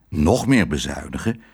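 ordinary delivery

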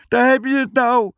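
frightened sailor shrieking over the wind; panicked shout. A narrator's reading. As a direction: yell it as the storm breaks. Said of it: "schreeuwde Piet".

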